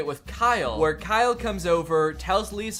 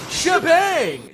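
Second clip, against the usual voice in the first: high, loud yelled catchphrase; imitated dog's big high shout; triumphant yell; 11 semitones higher, 7 dB louder than usual. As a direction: yell it out like a magic word. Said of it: "he yells".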